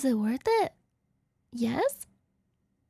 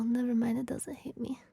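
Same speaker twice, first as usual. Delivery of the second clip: ordinary talk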